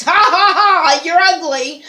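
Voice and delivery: high, loud jeering laugh; hammed-up taunting laugh at sick babies; mock bully. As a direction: sneer it like a cruel bully mocking babies. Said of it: "make fun of them little babies".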